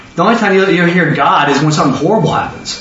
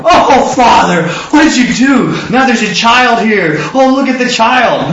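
excited prayer; 5 semitones higher than usual. Retold or imitated. Jesus voiced praying.

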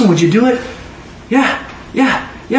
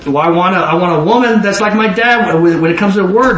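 usual speaking voice

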